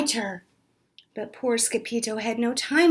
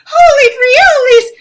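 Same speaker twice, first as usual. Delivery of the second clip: high, loud battle cry; high loud hammed-up exclamation; 14 semitones higher, 13 dB louder than usual. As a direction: thrust and shout it. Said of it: "cried Scapito".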